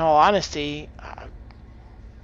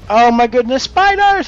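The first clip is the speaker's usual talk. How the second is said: higher, louder alarmed yelp; startled cry, pitch leaping up on the last word; 6 semitones higher, 8 dB louder than usual. Real, not acted. Player spots spiders in the game, startled.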